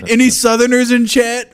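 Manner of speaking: high, loud, laughing through the words; laughing hard. Real, not acted. Teasing Southerners in chat, loud high laughing.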